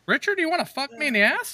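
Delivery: high, silly come-on; goofy high voice. Retold or imitated. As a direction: camp it up. Voicing Richard to himself.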